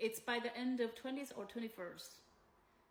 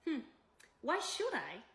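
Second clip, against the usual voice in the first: imitated dismissive reply with swooping pitch; dismissive retort; 3 semitones higher, 4 dB louder than usual. Host refuses to return the rent, mock dismissive. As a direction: imitate a scornful brush-off. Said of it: "you know what she replied me?".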